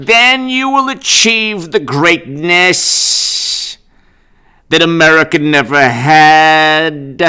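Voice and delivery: mock sermon. Vlogger mocking the governor's speech as a slow sermon. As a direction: stretch every word like a pompous preacher. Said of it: "this sermon".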